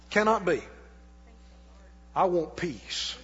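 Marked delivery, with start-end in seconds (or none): none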